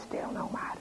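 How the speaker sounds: whispered hush, hand over his mouth. Acted. Young agent hushing the frightened father, whispered. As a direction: hush him in a secret whisper.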